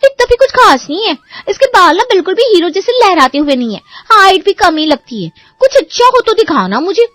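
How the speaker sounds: high, haughty brush-off; snooty dismissal. Acted. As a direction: turn up your nose at him, pitched high. Picky sister rejects groom photo. (नखरे से ऊँची आवाज़ में ठुकराना)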